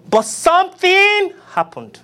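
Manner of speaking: high, slow rising refrain; soaring drawn-out proclamation; preacher refrain. Acted. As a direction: lift it like a revival cry.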